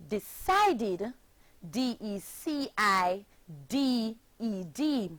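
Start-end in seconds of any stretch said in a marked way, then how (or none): none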